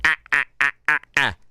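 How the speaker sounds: short, evenly spaced "ah" bursts; mock his mechanical laugh, beat by beat; mimicked staccato laugh. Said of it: "That's how he sounds".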